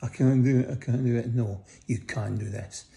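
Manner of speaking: normal talking voice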